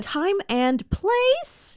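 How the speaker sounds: high, slow, drawn-out questioning; Tati Westbrook. Retold or imitated. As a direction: stretch it into a pointed, scolding question. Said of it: "as Tati Westbrook famously said".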